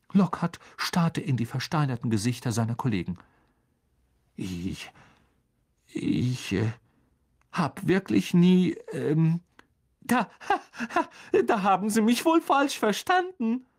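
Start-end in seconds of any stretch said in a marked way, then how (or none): 4.3-13.6 halting, then higher with nervous laugh; panicked stammer. A narrator's reading. Lockhart cornered.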